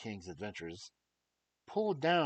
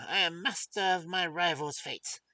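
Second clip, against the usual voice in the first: dwarf villain; 3 semitones higher, 8 dB louder than usual. Acted. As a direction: threaten like the wicked little dwarf. Dwarf's threat in story.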